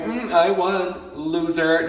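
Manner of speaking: high, loud, slowed sing-song taunt with a laugh; teasing victory jab at the uncle; mock gloating taunt. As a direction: rub it in like a playground winner. Gloating over uncle's broken bet.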